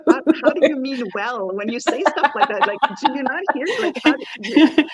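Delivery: high, loud incredulous challenge; laughing, hammed-up indignant protest; mock indignation. Acting out indignation at insensitive remarks.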